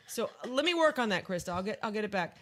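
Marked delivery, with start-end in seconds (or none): none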